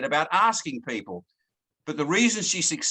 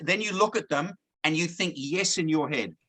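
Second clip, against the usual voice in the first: normal talking voice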